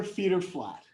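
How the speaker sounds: ordinary talk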